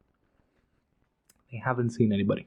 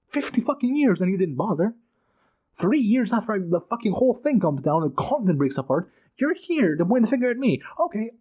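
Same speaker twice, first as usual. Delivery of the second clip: furious outburst; 7 semitones higher than usual. Retold or imitated. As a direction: hurl the blame back at them. Voicing the king's furious outburst.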